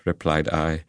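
ordinary delivery

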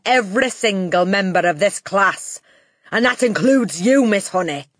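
loud, menacing headmistress; Trunchbull. A narrator's reading. Headmistress warning the class and their teacher, loud menace. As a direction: threaten them loudly, leaning on the key words.

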